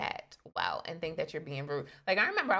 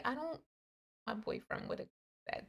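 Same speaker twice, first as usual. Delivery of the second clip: much softer, almost whispered; soft brush-off; 7 semitones higher, 9 dB quieter than usual. Retold or imitated. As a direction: breathe it out gently to let him down. Retold rejection line.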